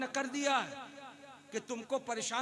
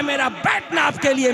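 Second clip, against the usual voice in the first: a loud vow, easing down a little; loud devoted vow; 3 semitones higher, 17 dB louder than usual. Retold or imitated. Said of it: "मोहब्बत का इजहार करके कहते हैं".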